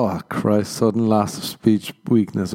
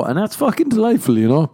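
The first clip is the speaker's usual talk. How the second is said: higher, louder mock delight; ironic gushing; 11 semitones higher, 5 dB louder than usual. Ironic gushing about slow internet.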